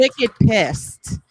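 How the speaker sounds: drawn-out Boston slang; Boston accent. Shown as a demonstration. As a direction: lean slowly into a Boston accent. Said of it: "it only comes out when I'm".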